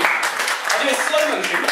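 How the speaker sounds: words drawn out slowly, in playful mock slow motion. Shown as a demonstration. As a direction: stretch each word out like a slowed-down replay.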